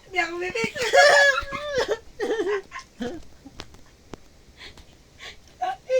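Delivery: loud high laughing cry; breaking into shrieking laughter; laughing shout. Real, not acted. Cracking up mid-story.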